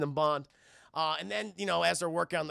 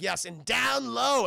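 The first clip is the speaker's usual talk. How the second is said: playful teaching; 5 semitones higher, 6 dB louder, slower than usual. Retold or imitated. John teaching high five.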